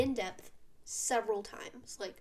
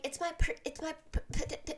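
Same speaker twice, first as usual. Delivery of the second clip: mock stammering girl; 6 semitones higher, faster than usual. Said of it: "she's like".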